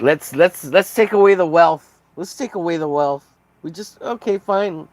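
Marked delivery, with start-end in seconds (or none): 0.0-2.0 rally the crowd with a mocking shout; loud mock call. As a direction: shout it higher and louder. Mocking rant, loud first take.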